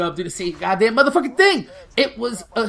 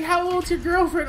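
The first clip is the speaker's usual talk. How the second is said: higher, lightly mocking question; high curious friend; 8 semitones higher than usual. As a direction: friends quizzing him, pitched up. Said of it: "they're like".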